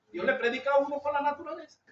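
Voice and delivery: ordinary talk, nothing marked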